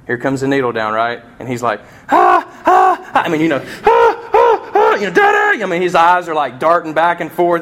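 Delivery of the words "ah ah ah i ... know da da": very high, loud mock baby screams; imitated toddler screams. Dad imitating his son screaming at the needle. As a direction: shriek like a terrified toddler.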